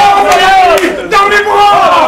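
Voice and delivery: high, loud and slow; excited shout. Acted. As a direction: cheer it out with joy. Overjoyed at tenor's voice.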